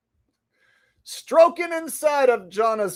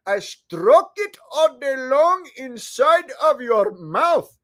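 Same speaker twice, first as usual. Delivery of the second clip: put-on comic Indian accent, louder; jokey Indian accent song; 4 dB louder than usual. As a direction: sing it in a hammed-up Indian accent. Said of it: "in the Indian voice".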